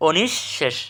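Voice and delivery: অনিঃশেষ split as "onish... shesh"; correct pronunciation. Shown as a demonstration. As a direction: pause between the two sh sounds in অনিঃশেষ. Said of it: "আমরা সঠিক উচ্চারণ কি?". (অনিঃশেষে "ওনিশ্"-এর পরে থেমে "শেশ")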